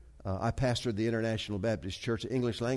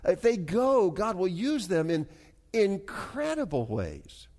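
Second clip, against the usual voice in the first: raised pitch with drawn-out key words; emphatic preaching; 7 semitones higher, 3 dB louder than usual. Real, not acted. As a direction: preach it with swelling conviction.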